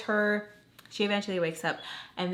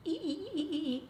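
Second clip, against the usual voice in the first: soft, high repeated squeaks; imitated squeaking; 9 semitones higher, 8 dB quieter, faster than usual. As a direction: mimic rhythmic squeaks in a tiny high voice. Imitates noises overheard from upstairs.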